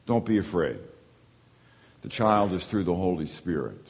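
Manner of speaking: gently calm a frightened man; the angel quieting Joseph's fear. Retold or imitated. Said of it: "the angel has to calm him".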